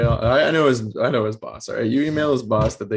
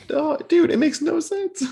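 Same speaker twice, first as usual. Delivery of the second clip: exasperated; slower than usual. Real, not acted. Exasperated agreement, very high.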